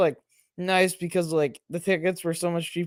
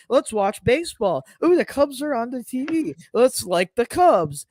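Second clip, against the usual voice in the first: casual fan voice; 6 semitones higher than usual. Retold or imitated. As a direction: play the easily won-over viewer. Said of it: "and they're like".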